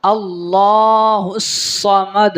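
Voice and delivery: "اللہ الصمد" slow, lā held long; lengthened lam. Shown as a demonstration. Teacher models the verse, slow chanted "اللہ الصمد". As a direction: intone "اللہ الصمد" with sustained vowel. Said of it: "اس کو لمبا کرنا ہے".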